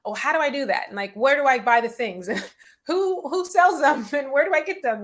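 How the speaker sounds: high, loud, flustered client laughing through it; confused client. Retold or imitated. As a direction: play the lost client asking too many questions, half laughing. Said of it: "I often get questions around".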